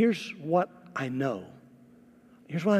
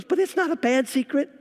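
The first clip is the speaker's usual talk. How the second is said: defensive bride; 12 semitones higher, 3 dB louder than usual. Retold or imitated.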